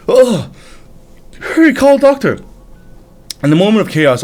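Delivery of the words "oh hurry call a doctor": high, frantic cry for help; panicked call. Acted. Courtier panics as prince collapses, frantic high cry. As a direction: shout for a doctor in a panic. Royals panic, calling doctor.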